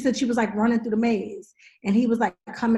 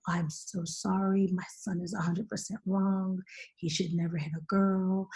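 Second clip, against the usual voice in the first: slow, low, hushed apology; soft-spoken; 3 semitones lower, 7 dB quieter than usual. Retold or imitated. Boy's mother apologizing for her son, soft-spoken. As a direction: imitate a soft-spoken mother, unhurried and quiet. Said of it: "was very soft-spoken".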